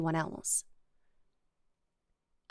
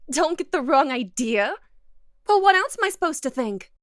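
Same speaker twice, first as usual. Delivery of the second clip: higher, louder and quicker; worked-up accusation; 4 semitones higher, 6 dB louder than usual. Acted. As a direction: push back, getting worked up. Defensive accusation.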